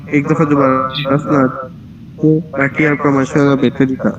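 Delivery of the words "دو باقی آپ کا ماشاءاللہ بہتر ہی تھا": ordinary talk, nothing marked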